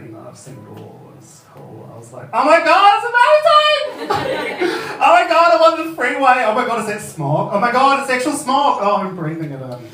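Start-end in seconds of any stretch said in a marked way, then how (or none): 0.3-1.9 very soft, low throwaway; underplay it as a too-cool imitation; jaded cool. Comedian mimics unimpressed queens in L A, jaded cool. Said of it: "like, some queens are like". 2.3-9.9 loud, high-pitched gushing; uncool excitement. Retold or imitated. Comedian relives first trip to L A, uncontained excitement. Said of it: "I didn't even try and be cool".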